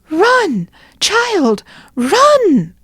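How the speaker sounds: high, urgent warning cry; frightened townsfolk calling out; crowd crying warning. Acted. The people crying to Nanina, high urgent warning. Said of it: "the people cried".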